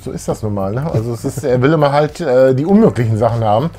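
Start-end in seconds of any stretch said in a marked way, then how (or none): none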